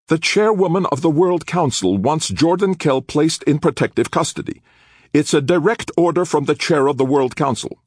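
high, loud insistent order; forceful command. Acted. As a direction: lay down the order.

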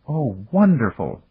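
slow, high-pitched delight; childlike excitement, voiced high; excited. A narrator's reading. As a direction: draw it out, thrilled as a child. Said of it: "as excited as a little child".

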